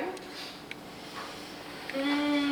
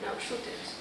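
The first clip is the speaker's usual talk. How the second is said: hushed challenge; 4 semitones lower, 11 dB quieter than usual. Retold or imitated. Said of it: "tell him".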